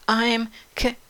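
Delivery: nervous stammer. A narrator's reading. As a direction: stammer as a timid girl. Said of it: "stammered Katy".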